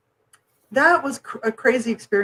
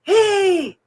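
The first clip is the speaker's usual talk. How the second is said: high, raised demand across a noisy airport; really loud; 10 semitones higher, 4 dB louder, slower than usual. Retold or imitated. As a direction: re-enact yelling for directions. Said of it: "really loud. I go".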